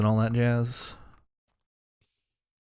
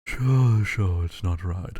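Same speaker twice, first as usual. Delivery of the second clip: a much lower, slow trial skull voice; trying out a deep slow voice for a skull; skull voice attempt; 5 semitones lower, much slower than usual. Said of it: "What voice do you do for a skull?".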